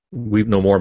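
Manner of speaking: ordinary talk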